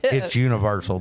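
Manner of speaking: ordinary talk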